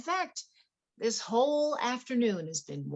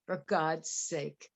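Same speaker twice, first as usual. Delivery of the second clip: bitter laugh; 3 semitones lower, 4 dB quieter, much slower than usual. Acted. Bitter laugh mid-accusation.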